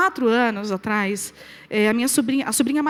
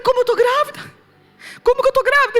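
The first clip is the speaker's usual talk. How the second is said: shocked disbelief; 12 semitones higher, 6 dB louder than usual. Retold or imitated. Shocked disbelief at doctor's news.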